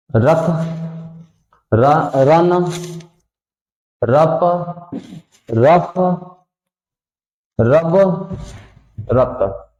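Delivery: रक, रन, रप, रफ, रब, रत with र sounded before the letter; r-first reading demo. Shown as a demonstration. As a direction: say the r first, then the consonant. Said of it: "वैसे ही पढ़ना है". (पहले र, फिर अक्षर बोलें)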